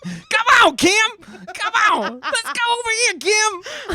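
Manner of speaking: put-on very high, loud, botched ogre accent; laughing, hammed-up accent attempt, straining upward; Shrek. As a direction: call out in a terrible cartoon ogre impression. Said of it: "I cannot do a Shrek accent".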